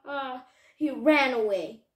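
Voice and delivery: ordinary delivery, nothing marked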